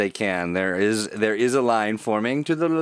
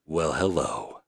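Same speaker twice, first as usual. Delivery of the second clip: low, lazy sultry greeting; 6 semitones lower, 3 dB quieter, slower than usual. Pretend. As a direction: purr the hello like a seducer. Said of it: "I got a little sultry voice".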